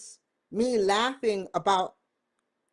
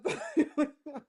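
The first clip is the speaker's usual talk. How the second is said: 1 real high laugh; 8 semitones higher, 6 dB quieter than usual. Real, not acted. Laughs after saying I love.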